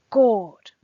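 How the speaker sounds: sudden loud exclamation of "God"; emotional interjection. Acted. Teacher quoting the poet's interjection to students, emphatic exclamation. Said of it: "that emotional interjection here".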